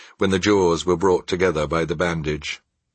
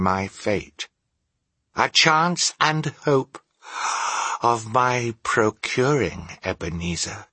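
measured spectral voice, swelling on the hope; solemn warning ghost; 6 semitones higher than usual. Acted. The ghost offers Scrooge a chance of escape, solemn warning. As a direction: warn gravely, drawing out key words.

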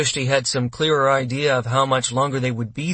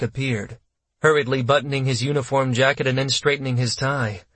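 ordinary delivery

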